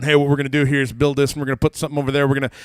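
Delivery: loud, quick-fire DIY plan; eager builder. Retold or imitated. Husband tearing down a wall at home, eager. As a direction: rattle it off loud and fast.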